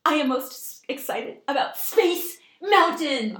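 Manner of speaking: high, loud, drawn-out proclamation; imitating an overjoyed little girl; hugely enthusiastic child. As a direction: savor each word, then burst with excitement. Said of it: "this enthusiasm".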